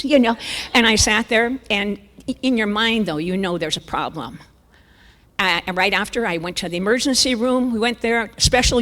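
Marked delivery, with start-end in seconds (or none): none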